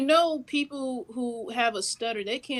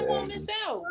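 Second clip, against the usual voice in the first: normal talking voice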